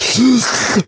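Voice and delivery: high gasping for air; drowning bee gasp. Acted. Bee gasping as mom drowns it, high gasp. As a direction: gasp like a bee held underwater.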